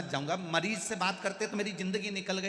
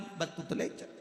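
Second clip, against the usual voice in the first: normal talking voice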